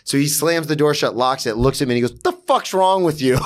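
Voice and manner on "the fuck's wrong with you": raised-pitch retold scolding; mock exasperation. Imitating the bar manager who disliked him, higher and exasperated. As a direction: imitate an annoyed manager's incredulous demand.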